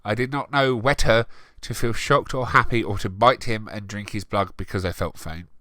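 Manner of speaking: ordinary talk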